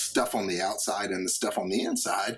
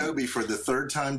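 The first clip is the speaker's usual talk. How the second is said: ordinary talk, nothing marked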